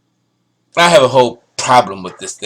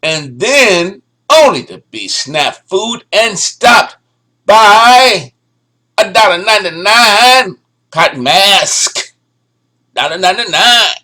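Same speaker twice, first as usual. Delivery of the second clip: higher and louder, slow and scornful; incredulous rant; 7 semitones higher, 6 dB louder, slower than usual. Real, not acted. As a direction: build outrage at the cheap mask. Mocking the cheap mask.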